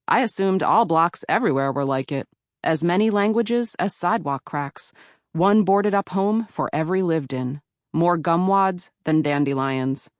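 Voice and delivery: usual speaking voice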